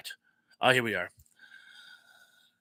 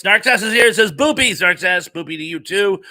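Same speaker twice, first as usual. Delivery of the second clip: high, loud burst of welcome; exuberant greeting; 6 semitones higher, 9 dB louder than usual. Real, not acted. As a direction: hail the newcomer with gusto.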